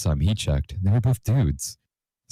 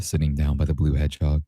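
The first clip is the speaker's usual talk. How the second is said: ordinary delivery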